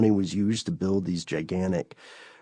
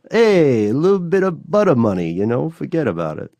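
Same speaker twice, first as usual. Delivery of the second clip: loud, high Brooklyn wiseguy, falling off at the end; badly faked Brooklyn mafia accent; terrible Brooklyn accent; 6 semitones higher, 5 dB louder than usual. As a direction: ham up a bad Brooklyn mobster accent. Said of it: "that terrible accent".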